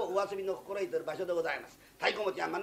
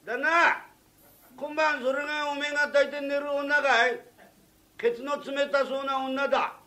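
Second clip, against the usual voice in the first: put-on loud, higher drunken bellowing at the boss; drunken yell; 8 semitones higher, 10 dB louder, slower than usual. Drunk craftsman yells at his boss about the courtesan, loud and slurred. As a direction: yell it across the room, sloshed.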